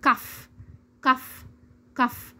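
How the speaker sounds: "كف" ending in a clear f; spaced model repeats of "كف"; final GH as F. Shown as a demonstration. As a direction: repeat "كف" as "kaf". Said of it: "نسمع الكلمات ونردد".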